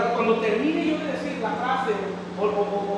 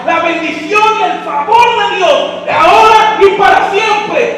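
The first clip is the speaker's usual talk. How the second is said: loud, rising preaching, slowing at the end; full-force preaching; 5 semitones higher, 12 dB louder, slower than usual. Acted. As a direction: preach it with fire. (predicación a todo pulmón)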